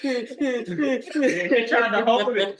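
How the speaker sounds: laughing. Real, not acted. Laughing about fiancée crying.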